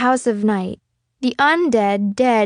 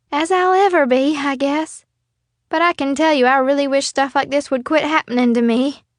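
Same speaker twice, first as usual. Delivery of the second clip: put-on higher, louder brave girl; 6 semitones higher, 4 dB louder than usual. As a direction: joke weakly through pain. Stevie Ray, weak but joking.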